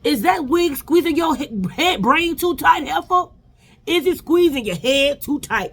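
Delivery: high, slow put-on reality-star voice; Nene Leakes voice. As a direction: stretch it out high and slow like a diva telling someone off. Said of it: "In the words of Nene Leakes".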